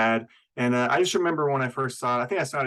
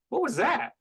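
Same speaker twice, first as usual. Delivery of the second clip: baffled; 12 semitones higher than usual. Retold or imitated. Viewer baffled by the performance.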